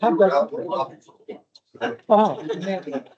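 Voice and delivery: teeth chattering from the cold; shivering through chattering teeth. Real, not acted. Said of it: "my teeth are chattering".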